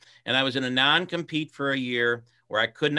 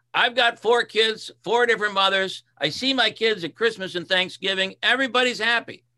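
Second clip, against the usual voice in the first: carefree boss; 8 semitones higher than usual. Retold or imitated. Boss quoted, carefree about kids.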